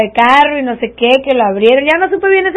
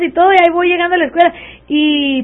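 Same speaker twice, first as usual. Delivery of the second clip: ordinary talk, nothing marked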